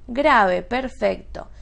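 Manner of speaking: ordinary talk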